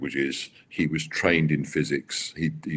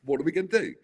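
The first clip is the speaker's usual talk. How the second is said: much higher, giddy mock enthusiasm; excited Max; 14 semitones higher, 4 dB quieter than usual. Max gushing over the plan, high giddy imitation. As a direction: squeal it like a kid with a new toy. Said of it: "he was like".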